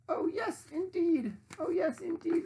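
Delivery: high, eager piggy voice. Acted. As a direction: answer as if you can't wait. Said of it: "replied Pinkerton".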